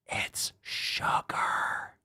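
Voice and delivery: whispered deadpan "It's sugar". Acted. Telling the viewers in a whisper that it's just sugar. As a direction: hiss it low like a secret.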